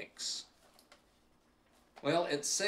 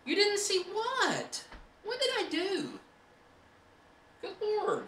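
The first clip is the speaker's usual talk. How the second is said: very high, indignant voice; high mock outrage; 4 dB louder than usual. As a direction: gasp it like a scandalised lady. Mock-outraged falsetto to chat.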